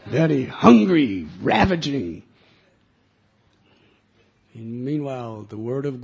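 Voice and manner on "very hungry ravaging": much louder, slow fierce words; ravenous snarl. Acted. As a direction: roar it like a starving beast. Bad dog grown fierce.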